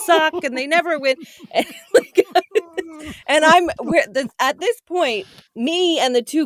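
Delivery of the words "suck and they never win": high, softened wail of a disappointed fan; mock upset rant. Wife imitating husband ranting about Toronto teams, mock upset. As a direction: rant in disbelief, pitched up but held back. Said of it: "going crazy because he's so upset".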